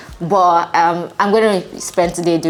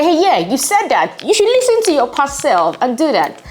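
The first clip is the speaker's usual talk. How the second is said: talking to future self; 8 semitones higher than usual. Pretend. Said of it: "come back to this video and say".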